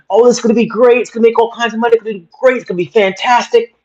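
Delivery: high, hammed-up gushing prediction; mock hype. Imitating someone predicting huge success, mock gushing hype. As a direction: play a starry-eyed optimist, overselling it. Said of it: "She could do, do predictions and say".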